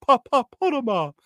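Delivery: high, stammering lion bluster; Cowardly Lion voice. Pretend. Host acts out Embiid as the Oz lion, pitched high. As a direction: stutter it like the scared Oz lion. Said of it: "Joel Embiid playing the role of the lion".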